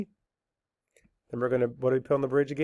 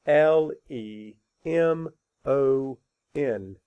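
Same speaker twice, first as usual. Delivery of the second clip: letters spelled out slowly; slow spelling; much slower than usual. Shown as a demonstration. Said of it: "Spell it".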